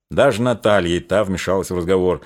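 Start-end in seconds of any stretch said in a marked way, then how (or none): none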